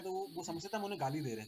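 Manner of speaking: ordinary talk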